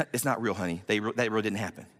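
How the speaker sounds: low, softened reassurance; acted soothing parent voice; parent reassuring a child. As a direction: gently talk a scared kid down, pitched low. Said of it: "talking to your kid".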